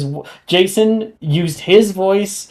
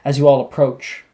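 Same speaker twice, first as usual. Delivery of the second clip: ordinary delivery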